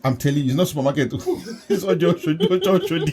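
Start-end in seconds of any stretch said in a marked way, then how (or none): 1.1-3.1 higher-pitched, laughing banter. Real, not acted. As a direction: say it through laughter, pitch climbing. Amused banter about the market.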